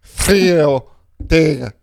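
slow French accent on Furio Tego, throaty r. Shown as a demonstration. Cohost tries the brand name slowly in a lightly put-on French accent. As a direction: roll a French r through the name. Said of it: "Could you try French?".

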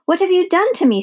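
high-pitched horrified squeal. A narrator's reading. Princess just turned into a warthog, squealing. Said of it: "squealed the princess".

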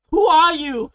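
very loud, high mock outrage; retold shouted comeback. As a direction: bellow the challenge, breaking into laughter. Acted shouted comeback to uncle.

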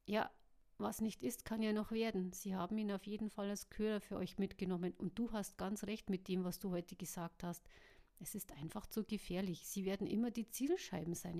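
usual speaking voice

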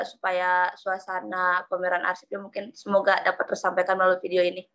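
ordinary delivery

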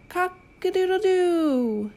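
high, slow rooster crow. Pretend. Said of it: "He can sound like a rooster".

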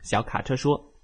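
usual speaking voice

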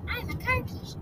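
high, soft panicked squeak; freaked-out rat. Acted. Freaked Rat blurting nonsense, high squeak. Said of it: "Rat was so freaked".

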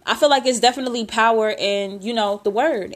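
normal talking voice

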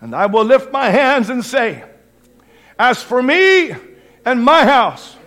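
high, loud, emphatic declaration; full-force preaching. Real, not acted. Preacher declaring his house will serve the Lord, full-force preaching. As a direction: thunder out an unshakable vow.

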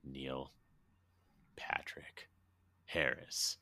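slow half-whispered name, long pauses between words; Ron Fox's hushed awe. Retold or imitated. Ron at the car window, dramatic slow hush. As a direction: say each name softly, nearly whispering. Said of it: "and he just goes".

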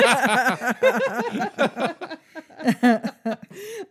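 hearty laughing fit; long loud laugh. Real, not acted. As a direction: laugh loudly for a while. Laughing at her own voice.

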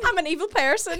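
put-on high Northern Irish accent, laughing. Friend echoing the evil person line, Northern Irish accent. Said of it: "I've got the darn accent down".

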